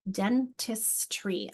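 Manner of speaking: Dentistry stretched out slowly and clearly; teacher modelling Dentistry slowly; slow model word. Shown as a demonstration. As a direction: drag out Dentistry for the class. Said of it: "her first syllable is den, second syllable tis".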